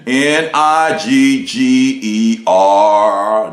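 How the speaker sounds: slow, spelled out N I G G E R; spelled letter by letter. Shown as a demonstration. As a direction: spell each letter deliberately.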